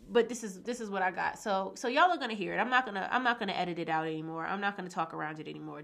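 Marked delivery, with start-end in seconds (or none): none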